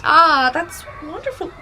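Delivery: gushing. Acted. Gushing at potty news.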